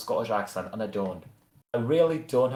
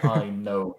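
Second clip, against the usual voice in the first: ordinary talk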